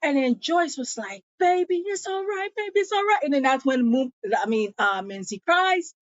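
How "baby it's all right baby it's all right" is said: high, gentle soothing voice; mock-tender reassurance; imitated comforting. Joyce comforting crying Menzie.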